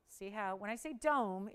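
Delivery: usual speaking voice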